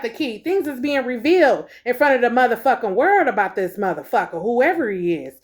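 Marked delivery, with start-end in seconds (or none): none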